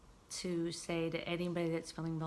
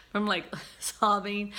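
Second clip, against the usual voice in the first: laughing; 3 semitones higher, 7 dB louder than usual. Real, not acted. Laughing while recalling.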